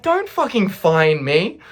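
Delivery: acted-out offended comeback; mock indignation. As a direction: throw the word back in outrage. Acted indignant retort in quarrel.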